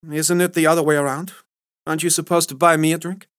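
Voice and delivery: a stranger at the bar turning the tables; lightly voiced flirty woman.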